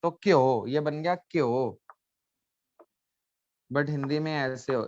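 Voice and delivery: normal talking voice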